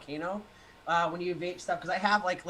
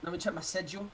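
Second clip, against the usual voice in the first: mock-British accent; imitated British voice; faster than usual.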